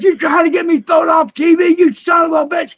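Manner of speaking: high-pitched, indignant mock tirade; imitated cussing out. Wrestler retells being cussed out over a promo, angry. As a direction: act out being furiously told off. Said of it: "cussing me out".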